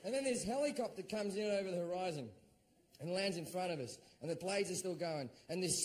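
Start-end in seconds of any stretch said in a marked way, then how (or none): none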